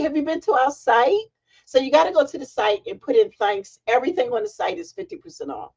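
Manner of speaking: ordinary delivery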